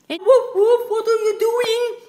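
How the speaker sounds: high loud call. Acted. Owner calling out high and loud to the dog at dinner.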